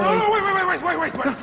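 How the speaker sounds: loud, high, frantic begging; desperate pleading. Acted. Father begging the captors to spare his son, panicked shout. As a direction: scream for them to stop, panicking.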